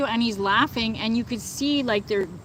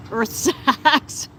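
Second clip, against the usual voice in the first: laughing; 3 semitones higher than usual. Real, not acted. Laughing at chat comment.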